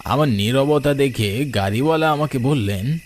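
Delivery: ordinary delivery, nothing marked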